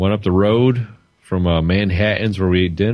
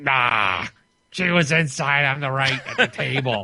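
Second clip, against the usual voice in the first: hag voice; 6 semitones higher than usual. Retold or imitated. Voicing the smoking patio woman.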